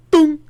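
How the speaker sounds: high, loud cartoon crash noise; imitated cartoon boom. Imagining a slow car crash as a cartoon, imitated boom. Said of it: "that's the noise that, that it makes".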